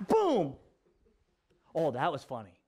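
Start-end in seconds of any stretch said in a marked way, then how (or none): 0.1-0.6 high, loud boom; imitated crash. Dad retells the block landing, loud imitated boom. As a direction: punch it out like a crash.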